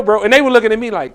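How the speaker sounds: usual speaking voice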